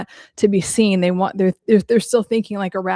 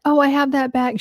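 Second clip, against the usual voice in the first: high, mock-boastful voice; lightly put-on bragging; imitated show-off; 6 semitones higher than usual. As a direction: imitate someone flaunting her things. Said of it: "have your whole personality be like".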